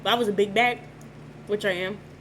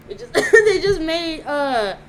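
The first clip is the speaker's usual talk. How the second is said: laughing; 6 semitones higher, 9 dB louder, slower than usual. Real, not acted. Overjoyed by roommates' dinner.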